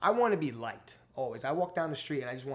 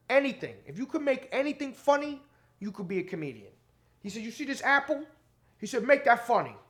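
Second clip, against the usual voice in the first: much higher, louder, different voice; Tracy Morgan impression; 8 semitones higher, 7 dB louder than usual. Comic imitating the star thanking the host, high and loud. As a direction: do a bad celebrity voice on purpose. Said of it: "Tracy Morgan impression".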